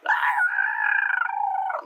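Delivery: very high held screech; long sustained off-putting note; mock belted wail. Mocking the actress's singing, high held wail. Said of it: "that ain't it".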